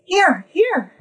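high, loud eerie chirp of two hovering spirits. Acted. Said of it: "and they say".

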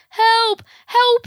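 high, loud desperate cries; cries for help. Acted. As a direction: yell for help while sinking. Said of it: "he cries".

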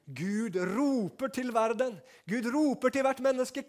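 loud, high, slow proclaiming; preaching at full force. Acted. Pastor proclaiming God's call to the world, loud and slow. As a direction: sound out the call to everyone.

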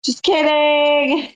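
high, long-drawn singsong; playful singsong. Real, not acted. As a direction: sing it out teasingly, very slowly. Repeats the joke retraction playfully.

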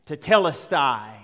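"tetelestai" said as Greek, firm and set apart; Greek word. Shown as a demonstration. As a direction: pronounce "tetelestai" in Greek, clearly and firmly. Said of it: "one word in the original Greek language".

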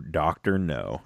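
"Doctor No" dropped low and soft; quiet punchline. Shown as a demonstration. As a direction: mutter "Doctor No" as a throwaway. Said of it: "Not Doctor Who".

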